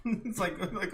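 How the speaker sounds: normal talking voice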